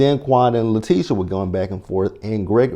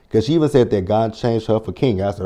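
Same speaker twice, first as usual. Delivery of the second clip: usual speaking voice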